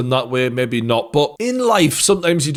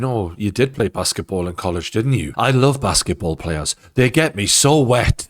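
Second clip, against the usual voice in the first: 1 mock seductive purr; 6 semitones lower than usual. Voicing boss seducing employee.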